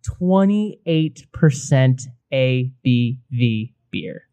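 very slow and low, word by word; dramatic reveal. Pretend. Host revealing the beer's strength, slow and dramatic. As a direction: draw out each word, sinking at the end.

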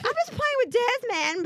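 high-pitched imitation of a kid; protesting child. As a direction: mimic a little boy's squeaky excuse. Mother voices her son's excuse.

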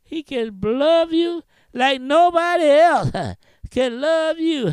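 rhythmic preaching with a huh after each phrase; whooping preaching. Acted. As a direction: chant it, breathing out a huh.

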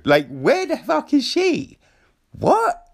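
high-pitched incredulous question; bewildered, voice shooting up at the end; baffled. Acted. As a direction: squeak it out in mock disbelief. Viewer's baffled reaction acted out.

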